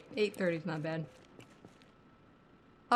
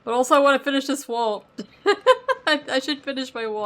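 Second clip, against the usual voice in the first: higher, louder laughing outburst; 6 semitones higher, 8 dB louder than usual. Real, not acted. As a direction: burst out laughing at the wall. Excited outburst with big laugh.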